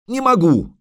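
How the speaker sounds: high, strained protest; desperate cry. Acted. Straining, hanging over the drop.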